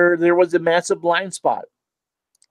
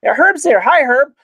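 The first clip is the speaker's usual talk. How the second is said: excited greeting; 11 semitones higher, 5 dB louder than usual. Real, not acted. Spots a friend in chat.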